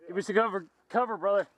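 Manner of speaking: ordinary talk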